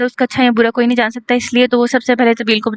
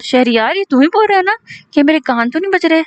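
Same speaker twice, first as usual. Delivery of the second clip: higher, teasing disbelief; a friend pretending to be amazed; mock disbelief; 4 semitones higher than usual. A narrator's reading. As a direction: act like you can't trust your ears. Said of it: "संजीदगी से बोलता है".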